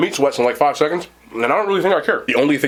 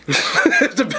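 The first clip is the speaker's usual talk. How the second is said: laugh; 11 semitones higher than usual. Real, not acted. Laughs at own description.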